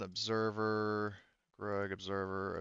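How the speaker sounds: ordinary delivery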